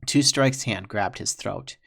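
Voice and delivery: plain reading voice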